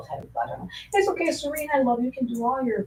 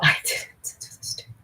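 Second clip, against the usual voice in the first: whisper. Real, not acted. Whispered denial.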